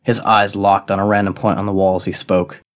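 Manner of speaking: ordinary delivery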